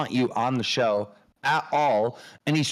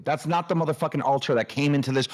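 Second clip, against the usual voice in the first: usual speaking voice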